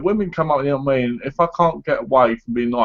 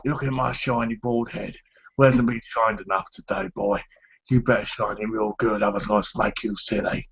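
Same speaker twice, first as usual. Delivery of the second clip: Jason Statham; 6 dB quieter than usual. Acted. Bald man showing off his film hard-nut act, hard-man voice. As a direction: do a quiet London tough-guy threat. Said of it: "do your Jason Statham, uh, voice".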